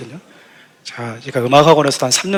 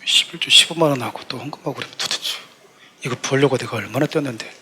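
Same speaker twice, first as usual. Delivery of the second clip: much softer, low grudging self-talk; muttered inner grumble; 4 semitones lower, 10 dB quieter than usual. Retold or imitated. Worker grudging his tithe money, soft low muttered grumble. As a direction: grumble to yourself, reluctant to give.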